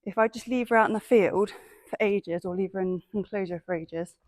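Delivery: usual speaking voice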